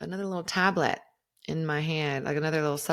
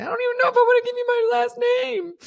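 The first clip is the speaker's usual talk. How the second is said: much higher, louder and fast, playfully alarmed; squeaky mock alarm; 8 dB louder, much faster than usual. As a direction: squeak it out like a startled cartoon. Wary of giving her name.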